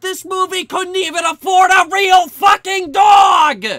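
loud, high, fed-up shout; exasperated shout. Real, not acted. Ranting at the cheap movie's missing dog, loud exasperated shout. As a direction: blow up at the film's cheapness.

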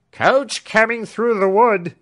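high, loud call from a distance. A narrator's reading. Servant calling that the coach approaches, loud. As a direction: cry out the coach is coming. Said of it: "cried Britain".

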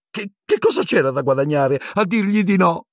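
high, stammering squeak; whining coward. A narrator's reading. Pettigrew asking what refusing would gain, whining squeak. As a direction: plead helplessly, pitched very high.

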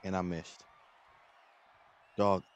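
ordinary delivery

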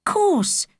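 put-on high, brief girl's reply; young girl's voice. A narrator's reading. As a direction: answer short like a girl swallowing disappointment. Holly hiding disappointment.

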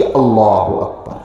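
low, drawn-out takbir; prayer takbir. Shown as a demonstration. Teacher showing listeners how prayer begins, slow low takbir. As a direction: intone it slowly, dropping low at the end.

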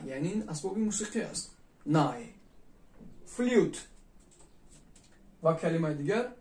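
normal talking voice

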